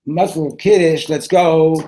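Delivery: loud, high rallying call; rally him to carry on; brisk urging. Acted. Urging friend to resume.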